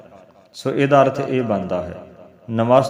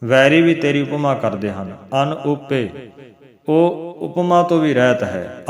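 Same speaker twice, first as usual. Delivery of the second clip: usual speaking voice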